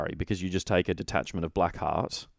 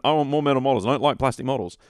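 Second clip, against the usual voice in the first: higher, louder self-mocking quote; mock past self; 6 semitones higher, 6 dB louder, faster than usual. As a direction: loudly parody your own foolish younger wish.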